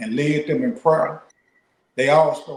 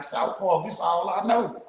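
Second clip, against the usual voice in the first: imitating a trembling, higher crying voice; shaky tearful voice; 5 semitones higher than usual. Retelling a scared prisoner about to go home, imitating his tearful shaking voice. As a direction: retell his fear with a quavering, higher pitch. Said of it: "His voice shaking and trembling".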